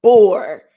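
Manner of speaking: "bore" said loud and falling; stressed bore. Shown as a demonstration. Mother showing the word her son dislikes, loud. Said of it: "not saying".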